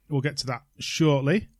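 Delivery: ordinary talk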